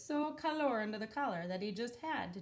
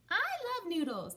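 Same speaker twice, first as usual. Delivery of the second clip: put-on high excited little girl; 11 semitones higher, 5 dB louder than usual. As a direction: squeal it like a small girl. Little girl character line.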